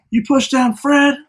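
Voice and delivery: high, slow accusing question; imitated confrontation. Classmates confront him after shove.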